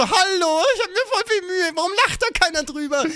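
high, whimpering plea, rushed at the end; hammed-up tearful complaint; mock crying whine. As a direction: sob it out like nobody gets your joke. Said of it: "Man ist dann so".

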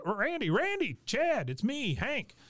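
high, eager calling out to friends; excited Hank. Retold or imitated. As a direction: shout hello to old buddies across a crowd. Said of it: "And he goes".

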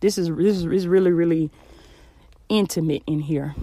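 close, muffled closet sound. Real, not acted. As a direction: speak low in a small closet. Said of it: "So I'm actually in my closet right now".

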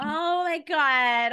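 high, loud and drawn out slowly; gushing in real excitement; a slow, thrilled exclamation; excited. Real, not acted. Reacting to Jersey Shore couple.

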